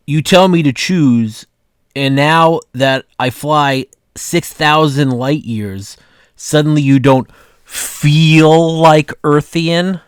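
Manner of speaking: drawn-out space alien complaining. Acted. Guy alien speaks in cartoon.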